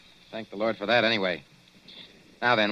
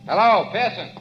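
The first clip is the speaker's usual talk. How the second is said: calling out; 9 semitones higher, 5 dB louder than usual. Acted. Calling into dark chamber.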